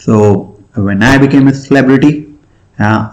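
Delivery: normal talking voice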